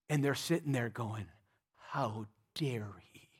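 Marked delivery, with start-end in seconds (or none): 1.9-3.3 whispered, slow outraged aside; indignant whisper. Acted. Pharisees whispering to each other, scandalized. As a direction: hiss it to each other in shock.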